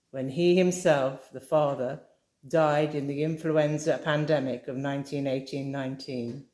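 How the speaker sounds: plain reading voice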